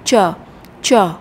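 "chờ" as a separate syllable; second syllable alone. Shown as a demonstration. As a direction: say "chờ" on its own, detached from "pick". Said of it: "Âm tiết thứ hai là".

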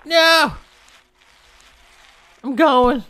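high, loud frantic cry; panicked scream. Real, not acted. Player running from the monster, panicked scream. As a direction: shriek while running away.

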